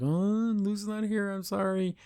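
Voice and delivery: put-on little voice delivering bad news; mock sorrowful. Friends admit Luz is missing, mock sorrowful.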